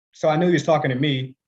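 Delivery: ordinary talk, nothing marked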